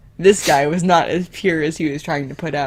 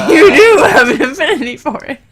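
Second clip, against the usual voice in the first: high, growling villain voice with laughter breaking in; 10 semitones higher, 7 dB louder than usual. Acted. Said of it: "The person spoke in a very high, growling type voice".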